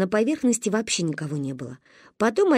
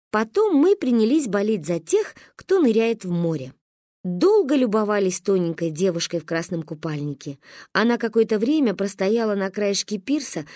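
usual speaking voice

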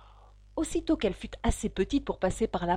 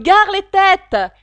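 cri d'alerte; 9 semitones higher, 15 dB louder, much slower than usual. Acted. Foule qui crie au-dessus.

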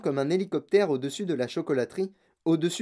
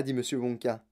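ordinary delivery

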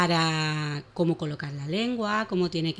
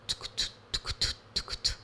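soft voiceless clip-clop clicks; breathy tongue-click hoof sounds; whispered hoofbeats; 9 dB quieter than usual. Acted. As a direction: tap out the hooves in a whisper. Horse hoofbeats onomatopoeia.

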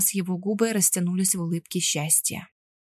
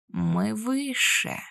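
very slow mocking alien voice; mocking monster; much slower than usual. A narrator's reading. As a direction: sneer it down like a beast. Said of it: "насмешливо отозвался исчадие".

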